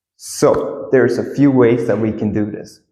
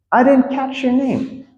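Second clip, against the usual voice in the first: high-pitched, connected phrase; high-voiced polite admission; acted demo; 14 semitones higher than usual. Shown as a demonstration. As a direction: say it in one go, pitched way up. Same phrase, acted at speed.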